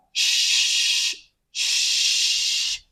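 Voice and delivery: "sh" as a long soft hiss of air; voiceless consonant. Shown as a demonstration. Teacher shows students nothing vibrates, "sh" sustained as pure air. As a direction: hold "sh" with no voice at all. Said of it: "no tiene sonido".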